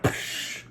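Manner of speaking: long hissing psh; imitated explosion. Storyteller mimicking the exploding egg, imitated explosion. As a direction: mimic the burst with a hushed psh. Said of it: "The thing went".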